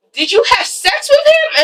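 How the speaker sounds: high, incredulous demand; acted jealous interrogation. Acting German's jealous interrogation.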